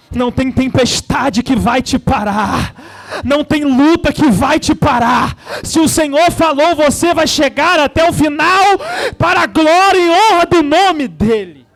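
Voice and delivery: loud, fervent shouted preaching. Acted. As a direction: preach it at full force to the church. Preaches at full force.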